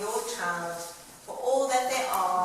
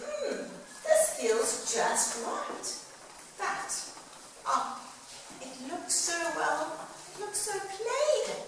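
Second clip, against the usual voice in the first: higher crab musing, then fretting; a picky little crab judging his new shell; lightly voiced crab, high and unsure; hermit crab voice; 9 semitones higher than usual.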